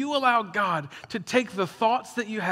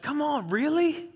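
high, incredulous protest; mock exasperation; 8 semitones higher than usual. To the nitpicky person, exasperated and incredulous. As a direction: push it up in mock disbelief.

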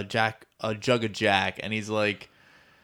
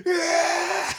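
excited yell; 5 dB louder than usual. Retold or imitated. Djinn going wild, retold.

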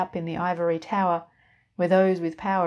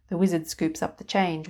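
ordinary delivery

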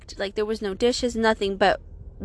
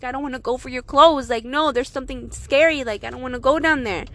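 ordinary delivery, nothing marked

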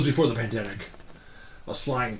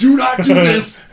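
mock panicked handler; 10 semitones higher, 10 dB louder, slower than usual. Handler pleads, laughing retelling.

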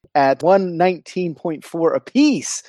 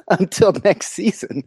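laughing; 5 semitones higher than usual. Real, not acted. Laughing while speaking.

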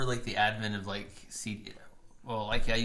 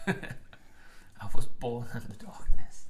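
slow, louder, whispery villain voice; Bane; 7 dB louder, much slower than usual. Retold or imitated. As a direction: draw it out like a masked villain. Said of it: "do a really good Bane".

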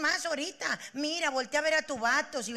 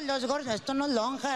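ordinary delivery

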